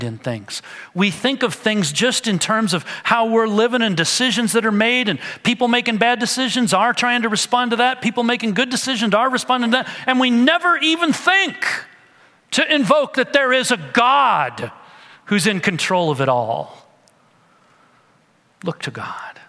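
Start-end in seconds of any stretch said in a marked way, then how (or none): 0.9-16.9 fervent preaching. Real, not acted. Preaching builds to full force. 18.6-19.4 hushed plea. Real, not acted. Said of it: "quiet close after climax".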